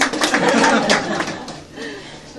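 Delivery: bright laugh. Real, not acted. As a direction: burst out laughing high. Bride laughs after joke.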